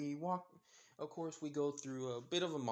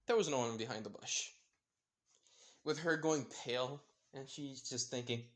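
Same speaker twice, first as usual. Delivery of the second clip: plain reading voice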